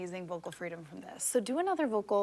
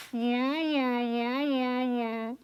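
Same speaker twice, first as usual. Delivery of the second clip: forward placement; 4 semitones higher, much slower than usual. Shown as a demonstration. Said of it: "throw our voice in the front".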